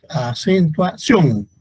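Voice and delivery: a-cen-tua-CIÓN, punch the ending; stress on last syllable. Shown as a demonstration. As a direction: land strongly on CIÓN in "Acentuación". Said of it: "la sílaba tónica es sion".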